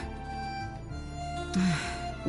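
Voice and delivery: long unimpressed exhale; weary sigh. Acted. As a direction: sigh heavily at a nuisance. Annoyed at being slowed down.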